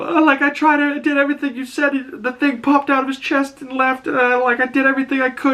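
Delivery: very high-pitched, hammed-up begging; mock pleading. Slightly pleading with Morrow over the failed task, mock pleading. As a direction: imitate a desperate kid pleading in a squeaky voice. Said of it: "Slightly starts pleading with Morrow".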